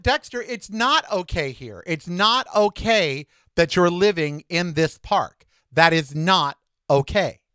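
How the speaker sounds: slow, with "not" hammered each time; firm insistence. Retold or imitated. Insisting to Dexter he can't stay, slow and firm. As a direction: lean hard on every "not", slowly.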